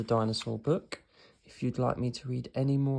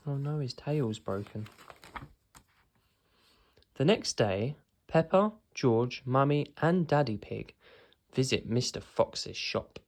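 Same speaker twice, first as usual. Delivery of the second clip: plain reading voice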